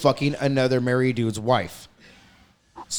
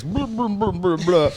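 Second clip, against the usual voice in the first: higher, mocking blah blah; lightly mocked droning voice; mock fat voice; 5 semitones higher than usual. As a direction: parody a fat guy droning on. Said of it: "I can hear a fat voice".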